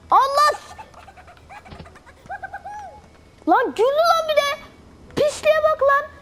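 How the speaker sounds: high, loud indignant shout; outraged yell. Real, not acted. Enemy laughed at him, outraged high shouting.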